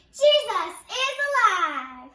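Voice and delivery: very loud, high jubilant cry; joyful shout. Acted. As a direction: belt out the good news.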